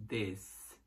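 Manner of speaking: This said as "dis", ending in a clear s; This with the final s; this with s sound. Shown as a demonstration. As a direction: end This on a hissing s, not z. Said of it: "Som de S".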